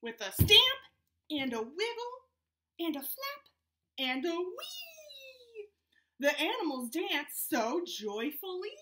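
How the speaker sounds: lively storybook read, voices up high; animated read-aloud to a Zoom class; reading to children. Acted. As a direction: read it out bouncy for little kids. Reads picture book aloud to kids.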